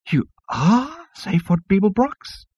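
slow, high, stunned squeak. Acted. Insect receptionist recognises Zaphod, squeaking in disbelief. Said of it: "it squeaked".

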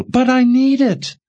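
high, loud desperate protest; desperate cry. Acted. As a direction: cry out, pleading. Jensen protests in despair.

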